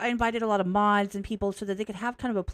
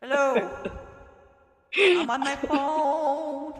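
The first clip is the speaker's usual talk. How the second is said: high, slow, auto-tuned with echo; auto-tune echo; 6 semitones higher, 4 dB louder, much slower than usual. Shown as a demonstration. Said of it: "bathroom with auto-tune".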